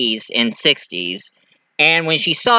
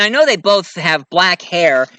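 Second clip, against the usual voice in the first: ordinary talk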